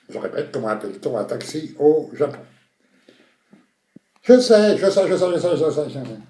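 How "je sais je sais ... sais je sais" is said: loud high burst, tumbling down; frantic repeated buzz-in; over-eager contestant. Acted. Contestant desperate to answer the host, over-excited.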